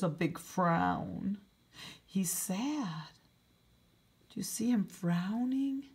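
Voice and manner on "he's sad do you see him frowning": whisper. Acted. Storyteller hushes to the children.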